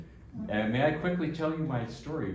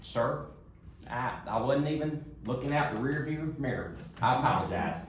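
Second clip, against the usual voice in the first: Larry the Cable Guy; 3 semitones higher than usual. Retold or imitated. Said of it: "you know Larry the Cable Guy".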